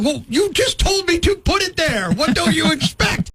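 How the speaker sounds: high, indignant comeback, stretched at the end; exasperated retort. Retold or imitated. Customer arguing with self-checkout, exasperated retort. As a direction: argue back at the machine in a high voice. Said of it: "I'm like".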